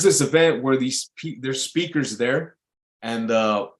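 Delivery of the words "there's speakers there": normal talking voice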